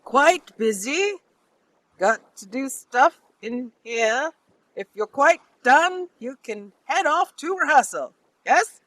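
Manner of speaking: high, loud, deliberately spaced words; loud, high-pitched brush-off. Acted. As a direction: project it pointedly so bystanders hear. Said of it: "he's being very loud for anybody else around".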